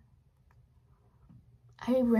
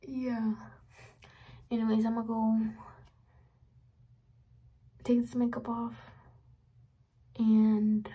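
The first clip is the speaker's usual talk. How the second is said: ordinary delivery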